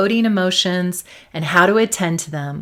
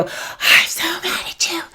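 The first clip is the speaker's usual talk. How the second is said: high, strained whispered yell; mock yelling; 10 semitones higher than usual. Shown as a demonstration. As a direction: yell at someone without full voice. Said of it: "And I'm yelling at you".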